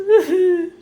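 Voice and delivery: choked laugh-sob. Real, not acted. Breaking down over lost partner.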